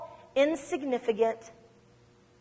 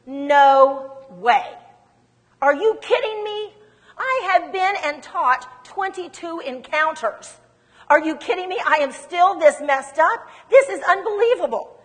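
raised pitch, emphatic incredulous outburst; acted-out exasperated astonishment; indignant disbelief; 5 semitones higher, 5 dB louder than usual. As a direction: protest as if you can't believe it. Acting out her indignant, disbelieving thought.

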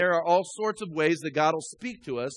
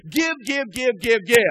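higher, insistent mock demand; mock hounding; 5 semitones higher, 5 dB louder than usual. Pastor imitating a preacher beating people for money, mock hounding. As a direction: badger them word after word, hammed-up. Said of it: "just beat them and say".

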